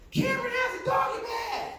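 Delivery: put-on high, loud childish shout; high kid voice tattling on his brother; kids yelling. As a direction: shout it like a giggling little boy.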